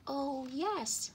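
high, glad little bird voice; small female sparrow, high-voiced; shy little sparrow. A narrator's reading. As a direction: answer gladly, pitched well up. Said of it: "said the shy little sparrow".

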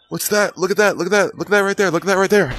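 high, eager look at that; excited pointing. Real, not acted. Gamer points out something to viewers, excited and high.

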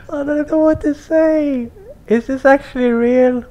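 real giddy shock; stunned high squeal. Real, not acted. Stunned by rare game cars.

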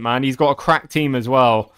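ordinary talk

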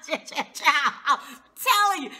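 high, loud laugh spilling into the words. Real, not acted. After calling Tiffany a dog, laughing loudly. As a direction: laugh through it. Laughs at her own joke.

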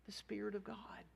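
soft unvoiced whisper, leaning in; whispered answer. Pastor answering his own question, whispered. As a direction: breathe the answer to the congregation.

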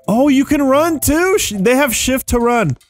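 high-pitched burst of discovery; just found a great new feature; delighted surprise. Real, not acted. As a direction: pitch jumps up in excited surprise. Discovers running in the game.

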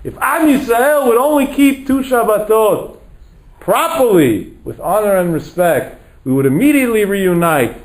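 high, ringing sermon climax; fervent rabbinic closing appeal; impassioned preaching. Real, not acted. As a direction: exhort the congregation, pitch held high.

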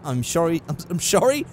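mock Scottish slur, "sorry" as "shorry"; accidental Scottish impression with a slushy "sh"; Sean Connery. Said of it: "I suddenly turned into Sean Connery there".

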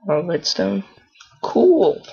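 high, loud excited cry. Real, not acted. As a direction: burst out with delight. Excited at redstone.

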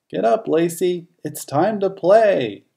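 calling the dog. Acted. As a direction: call her up to play, bright and eager. Owner calling the dog to play.

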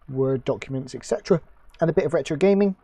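hiccuping mid-sentence; words jolted by hiccups. Real, not acted. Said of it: "Excuse me, I've got hiccups".

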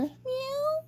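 high soft meow; kitten meow. A narrator's reading. Kitten answers the bird with a high meow. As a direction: mew like a kitten.

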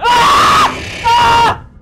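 high loud shriek; terrified scream. Real, not acted. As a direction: scream in real terror. Jump scare.

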